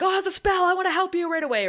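high, loud, rushed imitation of eagerness; really eager. Willow volunteering to help with the spell, really eager. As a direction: jump in, bursting to help. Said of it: "Willow's just really eager".

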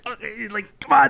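impatient hesitant mumble building to a plea; mock exasperation. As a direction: imitate an antsy listener bursting to speak. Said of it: "I can see he's like".

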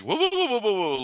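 high, rising mock whoas while losing balance; alarmed whoa. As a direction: yelp the whoas like tripping over.

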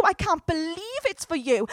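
normal talking voice

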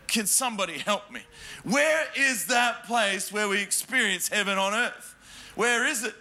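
usual speaking voice

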